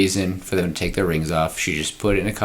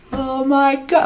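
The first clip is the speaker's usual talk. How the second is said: cartoon shock; slower than usual. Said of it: "zooms in on their face and they're like".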